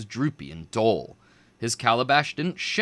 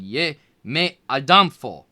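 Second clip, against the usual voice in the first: shouted; 2 semitones higher, 7 dB louder than usual. A narrator's reading. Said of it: "and shouted".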